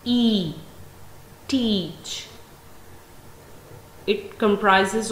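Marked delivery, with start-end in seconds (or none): 0.0-0.5 "E" held as one long vowel; long vowel sound. Shown as a demonstration. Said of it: "make a long vowel sound". 1.5-2.3 "teach" with a long ee in the middle; long vowel. Shown as a demonstration. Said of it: "make a long vowel sound".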